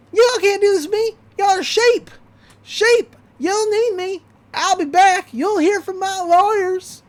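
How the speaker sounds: very high, loud bratty rant; shrill Gideon tantrum. Retold or imitated. Gideon ranting at the townsfolk, high and loud. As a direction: scream it like a spoiled kid villain. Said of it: "And he's like".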